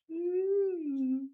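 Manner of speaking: high, loud "mm" moan; imitated human-like moan. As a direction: mimic a cat moaning like a person. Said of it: "This is like a human moan. She goes".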